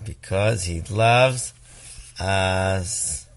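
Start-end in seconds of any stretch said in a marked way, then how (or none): none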